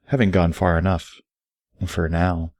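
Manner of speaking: ordinary delivery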